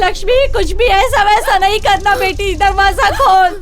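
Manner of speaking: frantic attempt to reassure; desperate pleading. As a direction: plead loudly through the door with your child. Begging daughter not to harm herself.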